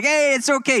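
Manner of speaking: put-on high, loud, easygoing Canadian accent; cheery Canadian impression. Pastor imitating his injured Canadian friend reassuring everyone, cheery Canadian accent. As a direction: brush it off in a hammed-up Canadian voice. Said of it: "He's Canadian, so you kinda get this".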